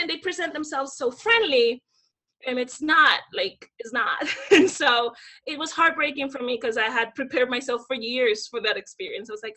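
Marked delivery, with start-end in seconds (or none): none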